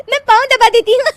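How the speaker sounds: high-pitched giggling fit through the words; helpless laughing. Acted. Daughter-in-law offers mother-in-law a foot massage, helplessly laughing. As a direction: offer help while cracking up. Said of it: "ऊपर से तुम हंस रही हो".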